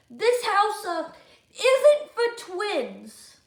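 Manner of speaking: loud, high, very slow rule; bossy decree. Acted. George banning the twins, loud and slow. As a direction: drag out the ban.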